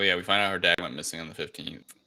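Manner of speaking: usual speaking voice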